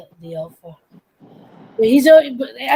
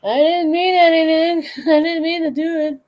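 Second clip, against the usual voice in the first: high, loud pleading whine; hammed-up whimpering apology, pitched way up; cowering crybaby cat; 9 semitones higher, 7 dB louder than usual. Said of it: "he'll just crouch down like".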